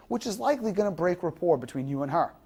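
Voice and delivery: usual speaking voice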